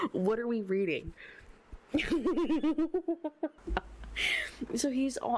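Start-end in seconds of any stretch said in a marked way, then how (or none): 1.7-4.7 high, rippling laughing fit; helpless giggling. Real, not acted. Reader loses it over what they are reading, high giggling. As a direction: dissolve into giggles.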